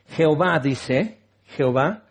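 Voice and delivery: ordinary talk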